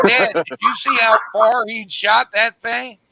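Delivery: high, loud imitation of an awestruck boy; excited kid. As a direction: gush to dad like an amazed youngster. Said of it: "them two boys".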